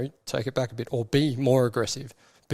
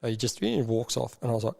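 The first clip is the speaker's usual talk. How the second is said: ordinary delivery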